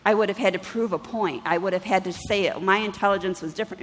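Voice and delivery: ordinary delivery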